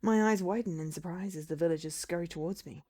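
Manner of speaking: plain reading voice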